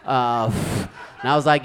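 ordinary talk, nothing marked

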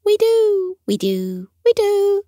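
tiny creatures volunteering all at once; high, eager cries of the dumplings; eager dumpling chorus. Acted. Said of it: "The dumplings all began to nod and call".

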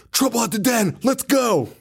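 high, urgent call; high, breathless alarm, hammed up; urgent nephew. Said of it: "the way he says it is so funny".

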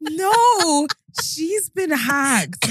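high, very slow and deliberate; exasperated. Retold or imitated. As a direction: spell it out to someone who won't get it. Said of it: "And I was like".

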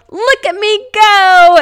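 high, drawn-out happy yell; loud, high-pitched shout across a field; retold yell. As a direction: call it out loud and long. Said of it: "and I yelled".

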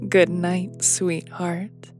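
plain reading voice

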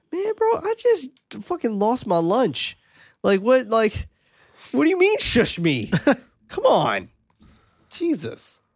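high, squeaky whiny protest; comic put-upon falsetto griping; mock indignant complaint. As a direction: whine it like you've been wronged. Plays shushed man who just vomited.

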